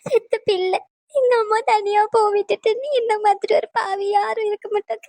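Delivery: slow, high sobbing wail; wailing lament. Acted. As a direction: wail to God, crying hard. Daughter wails after mother leaves. (கதறி அழும் புலம்பல்)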